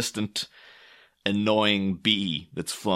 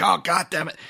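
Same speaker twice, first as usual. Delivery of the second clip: a touch of irritation, quickly shrugged off; mock-grumble like he'll laugh it off in a second; half-hearted curse, a little higher; mild annoyance; 8 semitones higher than usual. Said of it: "he'll be like".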